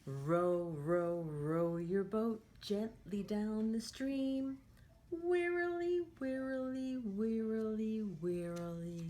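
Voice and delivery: sing-song storybook read for little ones; lively picture-book voice; reading to children. Acted. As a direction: read it like a teacher at story time. Teacher reads picture book aloud.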